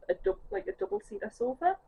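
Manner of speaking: ordinary talk, nothing marked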